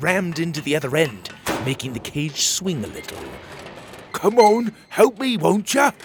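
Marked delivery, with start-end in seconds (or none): 4.1-5.9 high, loud call for help; Petey calling for help. Acted. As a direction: shout across the cage, urging.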